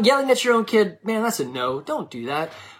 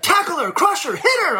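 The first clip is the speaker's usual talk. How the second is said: high, urgent sideline cheering; mock overzealous parent; 10 semitones higher than usual. Parent urging his daughter on at soccer, high-pitched mock fervor. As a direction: imitate a worked-up parent urging on the attack. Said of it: "one parent running up and down the line".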